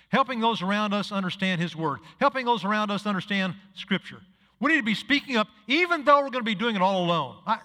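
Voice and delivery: normal talking voice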